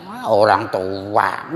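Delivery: slow, drawn-out mock reply; acted retort. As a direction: stretch it out as a mimicked comeback. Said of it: "Apa katanya?".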